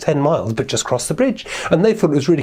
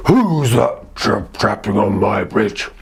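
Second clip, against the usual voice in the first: low, very slow troll challenge; 5 semitones lower, much slower than usual. Acted. Troll jumps up at middle goat, low drawn-out challenge. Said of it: "the troll jumped up again and said".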